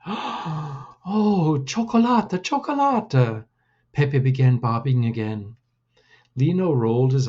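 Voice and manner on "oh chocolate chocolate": high, eager squeal of delight; a small child who can't believe his luck; little cousin thrilled at the word chocolate; excited little boy. Acted. Pepe delighted by chocolate offer.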